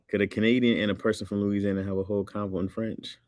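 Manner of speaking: ordinary talk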